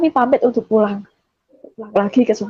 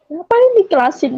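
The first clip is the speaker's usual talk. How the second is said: higher-pitched objecting question; retold indignant protest; 7 semitones higher than usual. Woman on phone refuses to let father go, indignant protest. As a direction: push back with a raised pitch.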